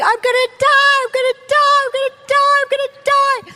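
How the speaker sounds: high, urgent re-enacted scream; retold screaming. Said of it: "I'm screaming".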